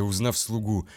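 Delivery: ordinary delivery, nothing marked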